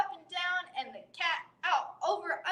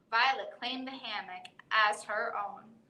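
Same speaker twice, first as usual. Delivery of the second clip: plain reading voice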